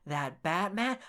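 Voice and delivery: lightly mocking imitation of a squeaky actor; soft put-on voice lifting at the ends; a hint of a high, mimicked Joker; high-pitched voice. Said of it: "his voice was really high-pitched".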